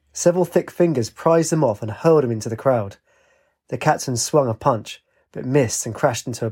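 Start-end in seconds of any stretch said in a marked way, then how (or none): none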